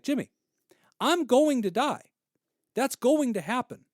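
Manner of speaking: high, loud, bright-spirited friend voice; friend Josh, upbeat. Retold or imitated. Dying friend cheering Jimmy up, high and loud. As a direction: cheerfully shrug off death and pivot to basketball. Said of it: "he would stop me sometimes and be like".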